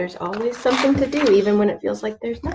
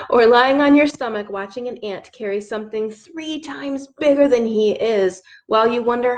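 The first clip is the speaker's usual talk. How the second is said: put-on reading to children; 4 dB louder than usual. Reads picture book aloud.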